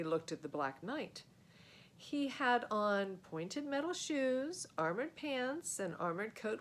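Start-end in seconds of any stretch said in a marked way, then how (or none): none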